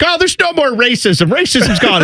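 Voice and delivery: hammed-up naive proclamation; mock naive everyone. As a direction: play the oblivious optimist. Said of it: "everyone's like".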